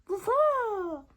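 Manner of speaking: high, loud woof woof; high dog bark. Acted. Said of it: "barks the dog".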